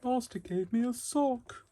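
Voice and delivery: very high, slow squeaky elf voice; Dobby the house-elf. Retold or imitated. After a Harry Potter joke, squeaky house-elf voice. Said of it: "not Harry Potter here".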